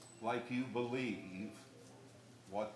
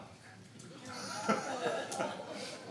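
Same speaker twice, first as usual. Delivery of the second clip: breathless, high, voiceless laughter; silent wheezing laugh; 14 semitones higher than usual. Real, not acted. Laughing at the skunk story.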